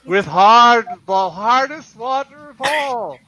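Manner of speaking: big, loud, high ghostly boom; booming ghost shout. Acted. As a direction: bellow it like a haunting spirit. Ghost booming in comedic bit.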